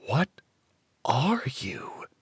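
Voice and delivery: breathy hushed whisper on are you. A narrator's reading. Girl asks the stranger what he really is, whispered. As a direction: lean in and whisper it, awed.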